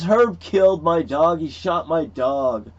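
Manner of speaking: retold holler, high and loud at first; upset accusation. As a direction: imitate a loud outcry, slowing at the end. Retelling an upset man's accusation.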